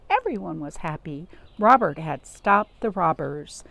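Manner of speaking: ordinary delivery, nothing marked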